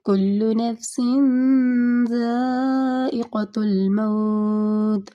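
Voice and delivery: slow, long-held recited verse; Quran recitation. Shown as a demonstration. Teacher reciting a verse to show the word, slow chanted recitation. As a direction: let the verse ring out slowly. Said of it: "اس کے بعد موت".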